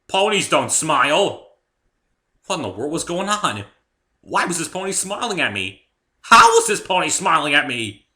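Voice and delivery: high, loud panicked disbelief; rising panic. A narrator's reading. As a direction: let the panic burst out. Narrator's panic at smiling pony.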